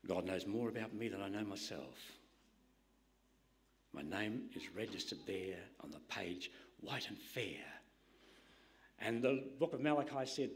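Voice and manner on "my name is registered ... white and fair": awed whisper. Real, not acted. Preacher's awed aside on salvation.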